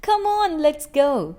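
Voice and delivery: high, loud cheer to urge on a team; fan's yell. Acted. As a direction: cheer like a football crowd. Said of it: "fans yell".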